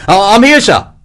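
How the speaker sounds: stammered high, loud shout; calling back. A narrator's reading. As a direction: stumble on the first word, then yell your reply. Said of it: "Hegswell called back".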